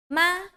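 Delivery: MA held high and level; first tone. Shown as a demonstration. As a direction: say "Ma" on a high, flat pitch. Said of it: "First tone is flat".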